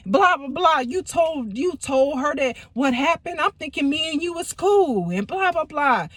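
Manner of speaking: high, heated mock yelling; angry yelling. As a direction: imitate a furious ex at the door. Ex yelling through the door.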